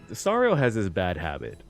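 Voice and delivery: normal talking voice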